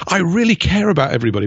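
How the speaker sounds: high, quick sugary mock sincerity; mock do-gooder. Mocking an earnest do-gooder, in a quick high sugary voice. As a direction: lampoon the earnest bleeding heart. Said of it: "You know".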